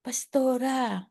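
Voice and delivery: put-on soft, slow frail old lady voice; weak voice of a mother figure; old woman's weak voice. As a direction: imitate nanay's faint call. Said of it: "Sabi niya".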